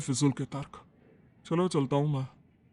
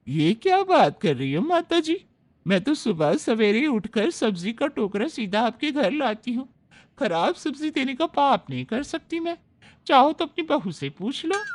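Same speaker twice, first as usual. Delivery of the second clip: much higher, put-on vendor voice, indignant; protesting vegetable seller; 6 semitones higher than usual. Vegetable seller protesting to the mother-in-law, high and indignant. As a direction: defend your honest vegetables to madam.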